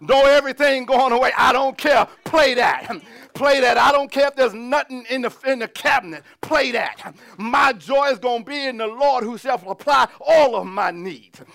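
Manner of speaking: loud, emphatic pulpit delivery; full-force preaching. Real, not acted. Pastor urging the congregation to rejoice in trouble, full-force preaching. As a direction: preach it hard, driving each phrase home.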